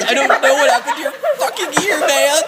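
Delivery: high, loud, frantic plea; panicked protest. Acted. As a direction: cry out as a terrified captive. Terrified captive answers interrogator.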